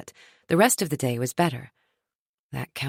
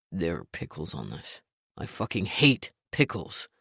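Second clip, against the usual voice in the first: low, irritated man's voice; gruff annoyed boss; grumpy boss voice; 12 semitones lower, 6 dB quieter than usual. A narrator's reading. Trent's lines, lower voice.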